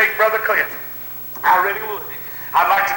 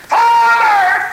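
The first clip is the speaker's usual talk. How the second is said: very high, loud, drawn-out call; soaring preacher's shout; anguished cry from the cross; 7 dB louder than usual. Retold or imitated. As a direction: cry out to heaven at full voice. Voicing Jesus crying out from Calvary.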